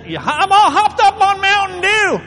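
loud, high hyped-up yell; hopped-up shout. Acted. Preacher voicing his Mountain Dew high, hammed-up shout. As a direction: belt it out as if overcaffeinated. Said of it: "You know, when it says".

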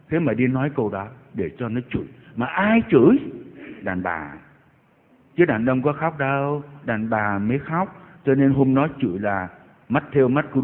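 ordinary delivery

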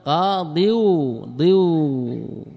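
قاضيو very slowly, then ضيو held long as "diyuuu"; slow demonstration of "qaa-diyuu, diyuu"; drawn-out syllables. Shown as a demonstration. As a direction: stretch out the ending ضيو. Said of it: "ماذا بقي؟".

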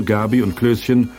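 normal talking voice

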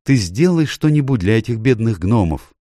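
understated tearful appeal; tearful plea. A narrator's reading. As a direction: beg with a touch of tears. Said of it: "проговорила Люси сквозь слёзы".

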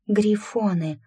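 high-pitched exclamation of wonder; narrated girl's enchanted cry; spellbound exhale. A narrator's reading. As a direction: breathe it out in awe. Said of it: "зачарованно выдохнула Ланка".

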